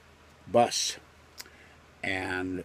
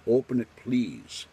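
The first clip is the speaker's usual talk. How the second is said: slightly raised, higher-pitched demand; imitated demand; 4 semitones higher than usual. Officer ordering a man to open his umbrella, mildly acted demand. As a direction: retell an officer's polite but firm order. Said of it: "he demanded".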